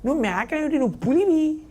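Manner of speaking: high-pitched mock rebuke of the cub. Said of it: "అంది". (పులి పిల్లను గద్దిస్తూ, ఎత్తైన గొంతుతో)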